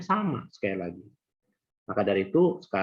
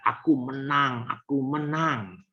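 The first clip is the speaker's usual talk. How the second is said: drawn-out, higher cry of triumph; retold victory cry; 5 semitones higher, slower than usual. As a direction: call it out slowly like a winner, raised pitch. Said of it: "Dia berteriak".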